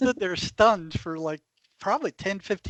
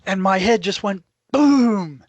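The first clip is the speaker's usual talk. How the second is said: slow, punched out and louder, as if the shock still lands; emphatic, slow and loud; 3 semitones higher, 7 dB louder, slower than usual. Real, not acted. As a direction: stretch it out and hit boom hard. Telling a shocking moment.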